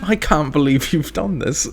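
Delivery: put-on British dismay; mock British accent. Host repeating the bit, mock British accent. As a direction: say it as an affronted Briton, hammed up.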